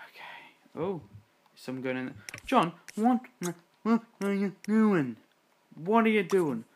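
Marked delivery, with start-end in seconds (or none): 0.1-0.8 breathy hushed okay; whisper. Real, not acted. Player whispering while the sim babies sleep. 2.5-6.6 raised, louder what are you doing; exasperated scolding. Real, not acted. Player scolding her sim John for entering the nursery.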